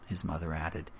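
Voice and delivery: ordinary delivery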